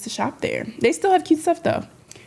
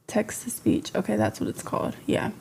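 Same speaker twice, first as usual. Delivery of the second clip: hushed aside under the breath; whispering; 8 dB quieter than usual. Real, not acted. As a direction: whisper it to yourself off-mic.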